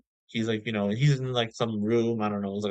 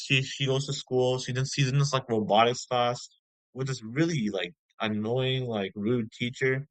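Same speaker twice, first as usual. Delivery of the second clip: normal talking voice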